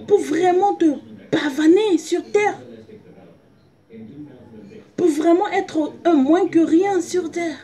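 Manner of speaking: high, loud impassioned preaching. Acted. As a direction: cry it out to the church. Preaching at full force.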